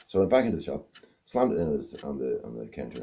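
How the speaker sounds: ordinary talk, nothing marked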